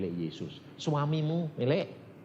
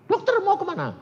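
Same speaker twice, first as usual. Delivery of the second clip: high, loud shouted question; calling out; 7 dB louder than usual. Retold or imitated. Escaped prisoner asks the doctor where he is going, high loud call. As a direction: imitate a man hailing the doctor.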